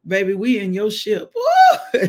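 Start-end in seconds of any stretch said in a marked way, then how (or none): 1.3-2.1 high, loud "woo" breaking into laughter; praise whoop. Real, not acted. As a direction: whoop with delight mid-prayer. Praise outburst, then laughs.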